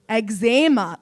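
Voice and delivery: "Eczema" drawn out as eg-ZAY-ma; playful mispronunciation. Shown as a demonstration. Comic trying it like a spicy alcohol, "Eczema" as eg-ZAY-ma. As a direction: say "Eczema" with a stressed ZAY in the middle. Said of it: "what's the actual pronunciation?".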